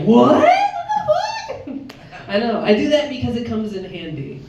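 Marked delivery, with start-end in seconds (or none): 0.0-1.9 second what squeaked way up high; incredulous what then a squeal; mock double take. Comic reenacting her own disbelief, sharp rise.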